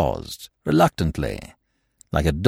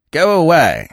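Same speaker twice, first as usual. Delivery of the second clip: sharper, louder go away; louder dismissal; 6 semitones higher, 5 dB louder than usual. Acted. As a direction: snap it out now.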